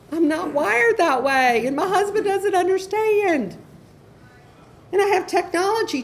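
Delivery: laughing. Real, not acted. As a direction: laugh it out, amused at herself. Laughing at her own weakness.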